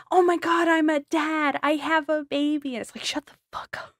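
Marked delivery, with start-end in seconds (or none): 0.1-2.7 high, loud gushing, hammed up; mock proud dad. Mocking a boastful new dad in a gushing loud voice. 3.0-3.9 whispered retort. Retold or imitated. Mock retort to the dad.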